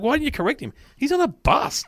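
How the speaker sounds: high, incredulous wife voice; mock exasperated wife. Comedian retelling, voices his wife's disbelief, pitched high. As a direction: raise it up, baffled. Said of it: "my wife's like".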